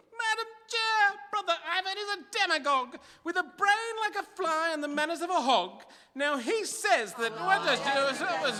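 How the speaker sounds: outraged demon cutting in to insult Ivan; indignant outburst. Acted. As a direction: burst in to denounce your rival.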